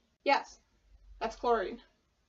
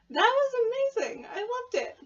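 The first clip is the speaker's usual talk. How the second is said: much higher, loud thrilled burst; excited; 12 semitones higher, 4 dB louder than usual. Real, not acted. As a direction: gush with real delight. Reacting to song ending.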